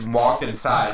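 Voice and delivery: ordinary talk, nothing marked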